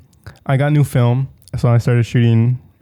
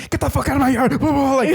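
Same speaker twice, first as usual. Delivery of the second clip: much higher screaming imitation of the neighbor; mock furious yell; 12 semitones higher, faster than usual. Retelling the neighbor chasing them off the yard, imitated screaming. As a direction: shriek it like an unhinged neighbor off her porch. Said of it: "she's just like".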